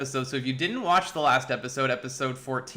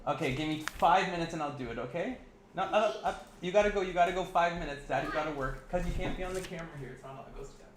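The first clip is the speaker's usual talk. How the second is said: hushed parental aside; 3 semitones higher, 6 dB quieter, faster than usual. Real, not acted. Aside to child interrupting the livestream.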